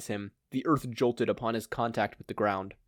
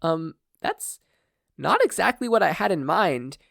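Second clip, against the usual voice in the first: nervous; 6 semitones higher, 4 dB louder than usual. Acted. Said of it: "Inko nervously pointed out".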